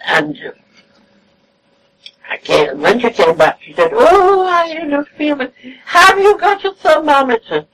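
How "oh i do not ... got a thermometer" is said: higher, mimicked plaintive complaint; plaintive invalid. The sick friend asking for a thermometer, in a higher plaintive mimic. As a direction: play the fretful patient asking for help.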